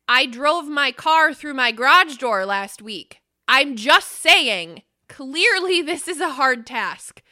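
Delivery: loud, drawn-out, punched-out protest; emphatic outburst. Real, not acted. Podcaster owning up to a garage door crash, loud emphatic outburst. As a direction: belt it out slowly in your own defence. Mock-defensive outburst with a confession.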